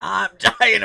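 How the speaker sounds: strained voice after choking; gasping through a cough; coughing up water. Real, not acted. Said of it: "drink went down the wrong pipe".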